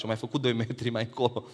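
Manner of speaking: ordinary talk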